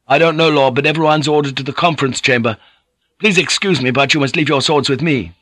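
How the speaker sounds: a jittery youth delivering bad orders; lightly voiced anxious young retainer; nervous young guard. A narrator's reading.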